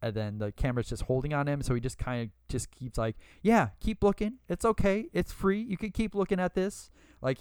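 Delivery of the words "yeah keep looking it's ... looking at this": cocky quote, pitched well above usual; arrogant one-liner. Retold or imitated. Podcaster quotes Bagwell riffing to the camera, arrogant. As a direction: toss it off high and smug, imitating the wrestler. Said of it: "arrogant little one-liners and things like that".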